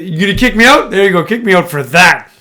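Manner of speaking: loud taunting challenge; defiant shout. Retold or imitated. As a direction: yell it daring them. Voicing Draymond daring ejection.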